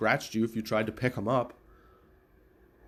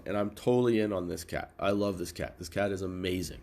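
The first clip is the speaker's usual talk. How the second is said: usual speaking voice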